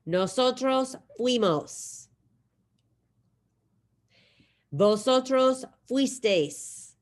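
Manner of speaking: careful Spanish drill voice; model pronunciation for repeating. Shown as a demonstration. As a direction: lay out "Tú fuiste", "Nosotros fuimos" slowly for the class to echo. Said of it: "I'm gonna say 'em for you".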